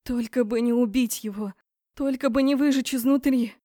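desperately will it not to go wrong; anguished thought. A narrator's reading. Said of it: "пульсировала в мозгу болезненная мысль". (болезненная, тревожная мысль вслух)

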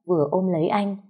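ordinary delivery

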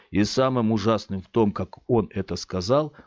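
plain reading voice

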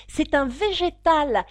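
a lightly voiced girl insisting; Alice correcting the duchess, a hint of a girl; young Alice.

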